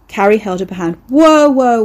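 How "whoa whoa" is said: loud, high stopping call; urgent whoa. Acted. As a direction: halt him mid-story. Carrie stops his story.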